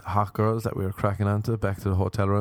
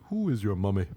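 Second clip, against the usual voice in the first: mock-teasing. Repeats it more deliberately.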